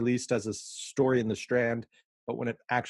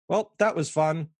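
casually breezy, pitched up and louder; breezy Sherlock; 5 semitones higher, 4 dB louder than usual. Retold or imitated. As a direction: toss it off like nothing happened. Sherlock's abrupt switch, paraphrased.

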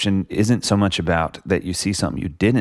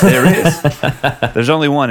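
laughing agreement; 8 semitones higher, 7 dB louder, slower than usual. Real, not acted. Laughing after host's joke.

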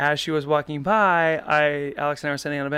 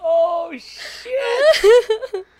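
high, slow, words squeezed through laughter; can barely get it out for laughing; helpless laughter; 6 dB louder, much slower than usual. Real, not acted. Husband breaks down laughing at the old memory, helplessly. Cracking up at the poop line.

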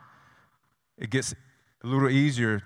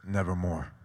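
low, dark taunting "Nevermore"; lightly voiced raven answer; low raven's taunt; 5 semitones lower, 3 dB quieter than usual. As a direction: intone it like the mocking raven. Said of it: "And the raven says".

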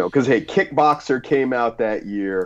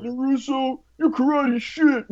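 movie quote impression; 13 semitones higher than usual. Quoting a movie line.

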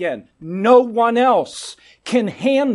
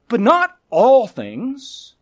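"not" lifted, louder and slow; stress on not; 2 semitones higher, 5 dB louder, slower than usual. Real, not acted. Preacher correcting what the congregation believes, stressing "not". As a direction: lean hard on "not all things".

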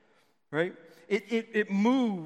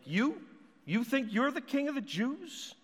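mocking, pitched higher, rising on each You; scornful disbelief; 7 semitones higher than usual. Shown as a demonstration. Pilate scoffing at Jesus the king, scornful rising. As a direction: scoff at the very idea. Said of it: "just a kind of a statement".

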